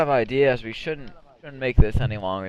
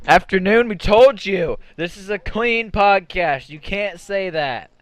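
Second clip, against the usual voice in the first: mock scolding; 8 semitones higher, 7 dB louder than usual. Scolding someone mock-sternly.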